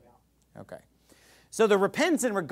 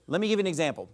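normal talking voice

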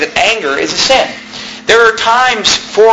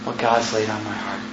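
mock soft-spoken preacher; 9 semitones lower, 12 dB quieter than usual. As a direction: drop to a soft, timid hush, mocking it. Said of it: "Why in the world would a preacher".

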